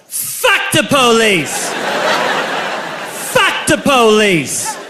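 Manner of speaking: put-on slow, dragged-out drunk shout; drunken yell. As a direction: bellow it like a drunk teen at a party. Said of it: "I yelled".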